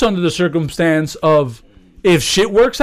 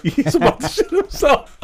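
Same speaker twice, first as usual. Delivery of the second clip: high-pitched, laughing through the words; laughing while talking; 11 semitones higher than usual. Real, not acted. Friend telling of a kid in distress, laughing as he talks. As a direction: crack up mid-sentence. Laughing at his funny story.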